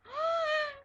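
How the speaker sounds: very high gasp. Retold or imitated. Fan retelling her reaction to Klaus dying, gasping. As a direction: gasp in mock horror. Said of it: "and I was like".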